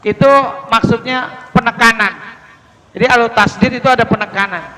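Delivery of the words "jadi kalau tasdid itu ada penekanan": ordinary talk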